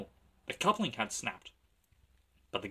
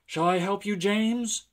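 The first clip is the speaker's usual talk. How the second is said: high, raised, friendly offer; calling out; 9 semitones higher, 6 dB louder than usual. A narrator's reading. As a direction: call across to the other engine. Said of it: "called Edward".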